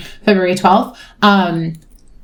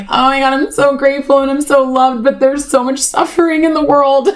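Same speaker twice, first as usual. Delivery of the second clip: higher-pitched voicing of her own inner protest; acted-out guilty gratitude; 6 semitones higher than usual. Torn between being loved and the world's suffering, acted out. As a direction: lift the pitch, replaying the guilt. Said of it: "And because I'm like".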